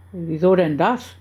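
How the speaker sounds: usual speaking voice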